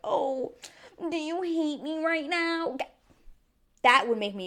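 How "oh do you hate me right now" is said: much higher and slow, a whiny mock of Paul; mocking whiny imitation. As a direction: whine "Oh, do you hate me right now?" to make fun of the ex. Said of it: "don't just ask me, like".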